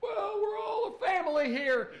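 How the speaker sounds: very high, meek mock voice; pleading bar owner. Owner refusing to fire staff, high pleading voice. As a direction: squeak out a feeble excuse.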